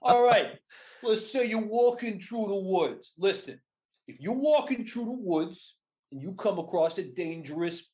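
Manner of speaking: thick New York wiseguy selling protection; New York mafioso accent. Shown as a demonstration. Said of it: "came across like a mafioso running a protection racket".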